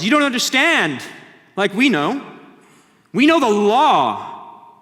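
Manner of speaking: loud, high, sneering Pharisee voice; scornful Pharisees. Acted. Pharisees mocking the guards, loud sneering voice.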